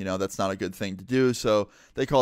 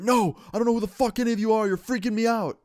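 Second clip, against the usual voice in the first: high, agitated imitation of a panicked man; freaked-out refusal; 11 semitones higher, 3 dB louder, faster than usual. Homeless man refusing to let go of friend, imitated frantic shouting.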